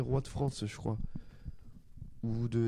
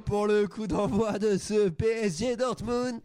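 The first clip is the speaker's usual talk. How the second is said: loud, higher match kickoff call; football commentator; 6 semitones higher, 4 dB louder than usual. Pretend.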